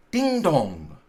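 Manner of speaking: loud, ringing "Ding dong" like a clock bell; bell chime. A narrator's reading. Narrator imitating the clock striking the quarter. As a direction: toll it out like a church clock.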